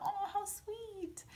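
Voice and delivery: melting soft aww; soft swoon. Retold or imitated. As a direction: breathe it out dreamily. Repeats swoon line.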